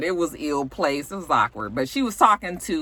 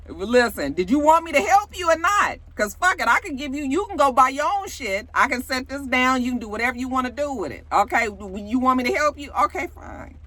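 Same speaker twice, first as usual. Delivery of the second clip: higher-pitched imitation of a fed-up helper; mock exasperation; 7 semitones higher than usual. Stylist arguing over helping with his outfit, mock exasperated. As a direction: argue it back, high and exasperated, as mimicry.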